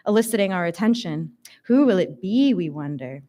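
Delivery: plain reading voice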